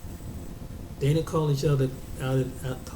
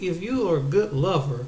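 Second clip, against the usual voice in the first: normal talking voice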